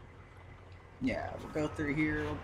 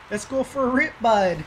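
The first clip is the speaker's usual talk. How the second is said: put-on excited, higher and louder Canadian accent; 6 semitones higher, 7 dB louder than usual. Gamer revs his new skidder in a hammed-up Canadian accent. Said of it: "That's my, uh, Canadian accent".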